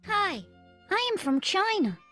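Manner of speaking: ordinary talk, nothing marked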